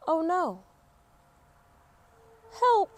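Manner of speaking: high, loud cry for help; stuck girl calling for help. Acted. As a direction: yelp it out, suddenly stuck.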